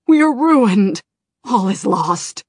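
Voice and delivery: loud, high despairing cry; panicked wail. Acted. Naragos crying out in despair to Kalecgos, loud panic. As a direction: wail that all is lost. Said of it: "Naragos cried".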